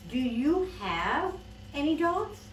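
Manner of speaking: pointed storybook exasperation; exasperated question. Acted. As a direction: spell it out for a dense adult. Exasperated girl asks pointedly.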